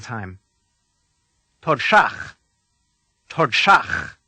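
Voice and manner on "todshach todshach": Todshach with curled d and sh, rasped ch; Klingon pronunciation. Shown as a demonstration. Teacher gives the whole word to repeat, Todshach harsh and rasped. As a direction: punch Todshach on the last syllable, ending like Bach. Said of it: "say the whole word".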